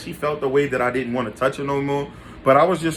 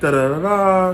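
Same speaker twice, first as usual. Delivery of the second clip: mock girlfriend denial; 4 semitones higher than usual. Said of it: "So she was like".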